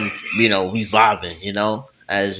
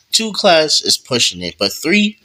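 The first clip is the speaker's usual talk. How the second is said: acted disbelief; 8 semitones higher, 3 dB louder than usual. Student reacting to shared class schedule, incredulous. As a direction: pitch jumping high in protest.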